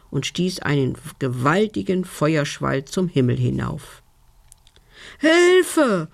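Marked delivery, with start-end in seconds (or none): 5.2-6.1 high, loud, drawn-out call for help; cry for help. A narrator's reading. Scorched fairy tumbling through the air, alarmed shriek. Fairy cries out, hit by fire.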